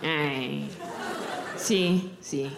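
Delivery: put-on low, slow old Mexican abuela cooing; great grandma voice. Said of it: "my great grandma's voice".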